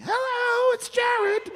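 very high mocking falsetto; Jared Kushner. Comedian imitating the man returning his prank call, absurdly high voice. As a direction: squeak it out as a cartoonish caller. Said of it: "then Jared Kushner called me".

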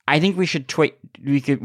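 ordinary talk

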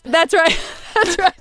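ordinary talk

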